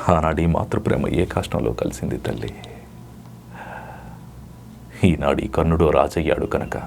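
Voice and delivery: a wounded son pleading, deep and measured; Karna's lament. Acted. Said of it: "ఒక dialogue చెప్పమంటే".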